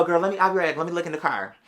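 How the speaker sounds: ordinary talk, nothing marked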